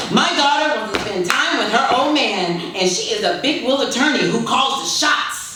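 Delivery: put-on a proud old father showing off; proud bragging dad. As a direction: crow about your daughter to anyone listening. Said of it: "he brags to the earth".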